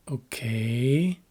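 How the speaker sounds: "okay" with a long rising "kay"; short O, drawn-out climbing "kay"; lengthened last syllable. Shown as a demonstration. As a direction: stretch and lift the end of "okay". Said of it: "pronounced with different duration".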